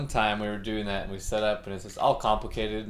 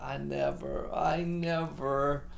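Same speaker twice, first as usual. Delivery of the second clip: whiny mantra; 4 semitones higher, 3 dB quieter, slower than usual. Retold or imitated. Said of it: "he just repeats this mantra".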